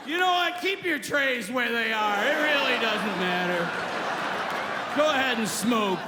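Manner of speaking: whooping, then drawn-out hollered announcements; frantic pilot. Acted. Pilot telling passengers anything goes, hammed-up and frantic. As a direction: play a captain who has given up.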